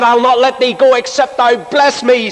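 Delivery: high, urgent plea; pleading Jacob. Retold or imitated. Jacob wrestling with God for a blessing, high urgent plea.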